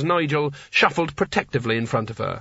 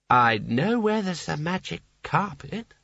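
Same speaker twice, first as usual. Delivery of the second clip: higher, very slow vague admission; tipsy Seraph admitting; 4 semitones higher, slower than usual. A narrator's reading. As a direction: drag it out sheepishly. Seraph offering carpet.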